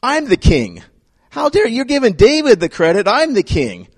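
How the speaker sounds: high, indignant king outraged at being upstaged; high-pitched affronted huff; jealous king. Retold or imitated. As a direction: play the offended king, voice jumping up. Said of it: "was like".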